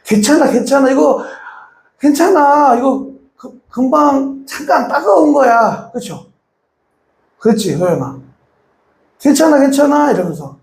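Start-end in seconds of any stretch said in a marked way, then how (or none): 0.1-5.9 high, gentle coaxing voice; soothing mother. Retold or imitated. Mother comforting her crying baby. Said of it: "막 울고 그러면". 9.2-10.1 high gentle repeated 괜찮아, 괜찮아; soothing mother. Retold or imitated. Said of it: "안심을 시켜주잖아요".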